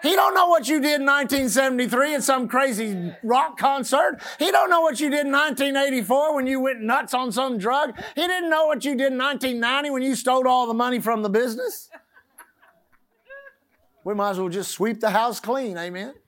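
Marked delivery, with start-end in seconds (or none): 0.0-11.9 loud, high, laughing shout; preaching at full force. Acted. Preacher shouts to the congregation, loud and laughing. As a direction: let it rip with joyful preaching.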